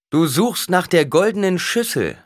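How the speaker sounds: high, loud oracle voice; stern proclamation. A narrator's reading. Alia answers the businessman, proclaiming sternly. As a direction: pronounce judgement across the hall.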